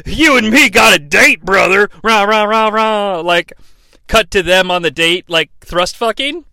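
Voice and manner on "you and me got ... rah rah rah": loud Hogan shout then chanted rah rah; Hogan with music. Retold or imitated. Mocking the promo mixed with entrance music. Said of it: "So it sounds like".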